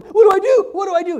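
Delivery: high, loud mock panic; panicked listener. Pastor voicing a desperate listener, loud mock panic. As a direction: cry out like a desperate churchgoer. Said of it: "You're sitting there going".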